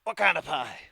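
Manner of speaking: bandit leader voice. Acted. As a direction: sneer at the offer like a bandit.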